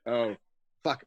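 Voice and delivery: ordinary talk, nothing marked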